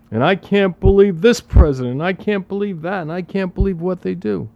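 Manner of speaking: mock complaining. Parodying complaints about the president.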